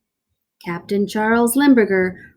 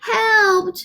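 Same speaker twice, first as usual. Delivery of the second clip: high crying shriek; 11 semitones higher than usual. Acted. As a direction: scream for help. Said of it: "shrieked Rosie".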